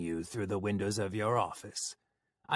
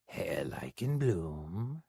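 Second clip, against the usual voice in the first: honeyed menace; sweetly; 3 semitones lower, 3 dB quieter than usual. Acted. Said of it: "he said sweetly".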